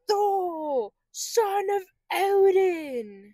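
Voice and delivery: high, loud, drawn-out mock-epic voice; grand heroic proclamation. Defending the actor as a god, mock-epic proclamation. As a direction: proclaim it like a mythic hero. Booming announcement of a god's title.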